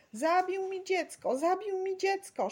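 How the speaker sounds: high, loud lament; acted grief, crying out high; sobbing wail. A narrator's reading. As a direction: wail it as a distraught mother. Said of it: "szlochała jakaś kobieta".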